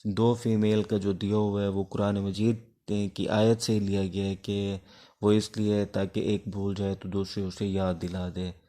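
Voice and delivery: ordinary talk, nothing marked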